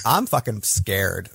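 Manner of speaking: ordinary delivery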